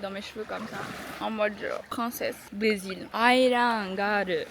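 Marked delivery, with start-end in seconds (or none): 3.1-4.4 "Island Girl" drawn out slowly in a mock Japanese accent. Vlogger posing as island princess, mock Japanese accent. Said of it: "C'est Island Girl avec l'accent japonais".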